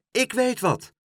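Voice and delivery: high, bright sudden idea; excited cry. A narrator's reading. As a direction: blurt out the plan. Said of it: "riep het oudste stampertje".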